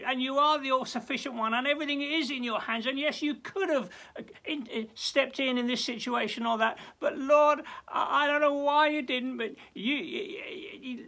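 high, loud, earnest prayer; worshipful prayer of trust; fervent prayer. Pretend. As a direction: declare trust with passion. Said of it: "And to say".

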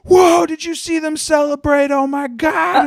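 mock excited. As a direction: overplay the lawyers' shock. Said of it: "And they're like".

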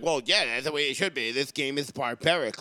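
sneering impression of a timid scold; lightly voiced prissy man, mocking; mock beta male. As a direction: play the pompous weakling, mildly. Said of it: "beta-ass fucking males".

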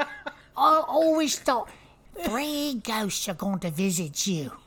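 slow, silly British voice; weird British accent. Acted. Making the listener laugh, slow weird British accent. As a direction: draw it out in an eerie, comic British voice. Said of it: "it's, like, British and weird".

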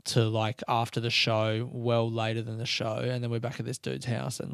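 usual speaking voice

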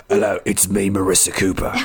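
low, comic British impression of a TV heroine; mock-gruff British Batman growling an introduction. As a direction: put on a deep, hammed-up British voice. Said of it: "like Roy Kent or something".

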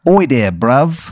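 slow, hammy fake Cockney accent; terrible English accent. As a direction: lay on a bad London accent, slowly. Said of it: "it's a terrible accent".

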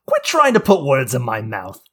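high, loud, stretched-out screech; comedian impersonation. Acted. Host tells co-host a second joke in impersonation, screeching. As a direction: belt out the joke in a grating put-on voice. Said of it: "but in the voice".

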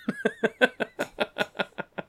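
high giggling fit; quick run of high chuckles; laugh. Real, not acted. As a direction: crack up at your own story. Cracks up at his own story.

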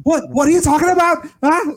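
squeaky, very high hyped-up voice; a hammed-up high-pitched fighter voice; high-pitched impression. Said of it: "such a high-pitched voice".

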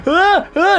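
sharp high yelps of pain; loud high pained gasps; pained cries. Retold or imitated. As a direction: cry out as the mark spreads. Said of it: "and he's going".

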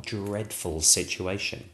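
plain reading voice

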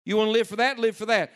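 rising preacher's challenge; calling out. Real, not acted. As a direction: push it out loud and high.

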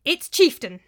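loud, high proclamation, pitch jumping; loud, cracking voice. A narrator's reading. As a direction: call it out with the voice cracking on some words. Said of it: "His voice cracked as he spoke".

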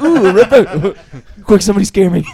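loud, high, laughing mock voice; mocking a friend's tic. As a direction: parody a friend blurting it out. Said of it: "Dan's got racism like the hiccups".

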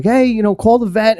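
mildly acted frantic plea; nervous screaming, retold. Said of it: "he was nervous ... screaming".

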